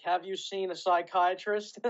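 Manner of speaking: mock checklist question. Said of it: "This guy would pull out his checklist and be like".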